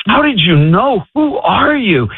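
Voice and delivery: high-pitched incredulous questioning; acting out someone baffled, pitch climbing; retold bewilderment. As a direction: demand answers from a mystery stranger. Retold baffled texts to a stranger.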